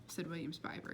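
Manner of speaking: ordinary delivery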